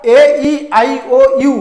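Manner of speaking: slow, spaced-out vowel letters. Shown as a demonstration. Teacher listing vowel letters for students, slow and spaced out. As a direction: spell out each vowel deliberately. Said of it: "ଦ letter".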